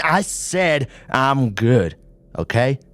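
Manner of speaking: irritated snap at his partner; snapped. A narrator's reading. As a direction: bite back at her, slow and hard. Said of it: "Paul snapped".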